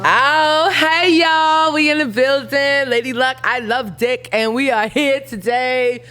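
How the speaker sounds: hammed-up rapper hyping the crowd; hype female rapper persona. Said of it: "a straight female rapper".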